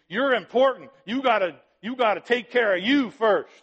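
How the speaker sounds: voicing the world, high and loud, self-centred; mock selfish world. Preacher mocking the world's voice. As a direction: puff up as the selfish world talking. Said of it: "The world says".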